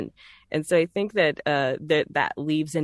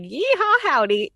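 high, loud over-the-top Southern cowboy holler; exaggerated cowboy Southern; 12 semitones higher, 5 dB louder than usual. Shown as a demonstration. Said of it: "if I come at you like".

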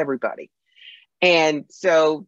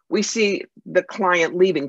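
usual speaking voice

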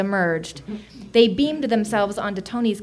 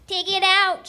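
high-pitched shrieked command; high, demanding creature voice; alien shriek; 8 semitones higher than usual. A narrator's reading. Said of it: "shrieked another".

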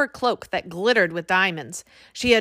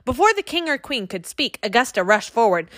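plain reading voice

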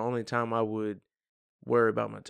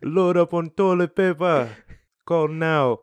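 loud, high Jamaican hotline ad; Jamaican accent; 6 semitones higher, 9 dB louder, slower than usual. Pretend. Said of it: "talk in a Jamaican accent".

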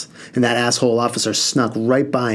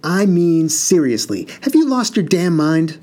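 dad losing it; 7 semitones higher than usual. Acted. Father exploding at his eldest son, furious outburst. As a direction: blow up at him in disbelief. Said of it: "my dad lost it".

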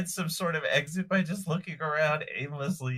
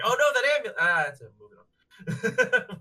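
mock dismissive; 7 semitones higher, 6 dB louder than usual. Mocking the shrug at amulet.

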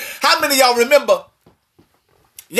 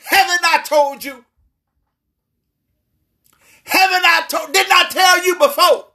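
higher, pressing reminder; a touch of a raised voice reminding Joshua; insistent rising reminder; 6 semitones higher than usual. Retold or imitated. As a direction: push the reminder up in pitch. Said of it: "he came back to verse nine".

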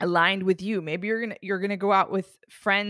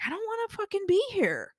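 high, mock-whiny grumble; sulky gym-goer complaint, pitched way up; 13 semitones higher than usual. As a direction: whine like you're dragging yourself to the gym. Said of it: "I'm like".

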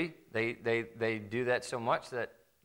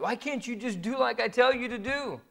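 whining parent; 10 semitones higher than usual. Retold or imitated. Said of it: "don't whine for it".

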